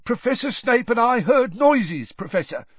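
eager, very high-pitched underling; Filch. A narrator's reading. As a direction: report at once in a high, loud voice. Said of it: "said Filch at once".

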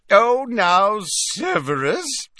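put-on high, slowed pleading as a merry old professor; tipsy Slughorn. A narrator's reading. Professor Slughorn soothing Snape at the party, high and tipsy. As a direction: Slughorn coaxing, a bit drunk. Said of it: "said Slughorn, hiccuping again".

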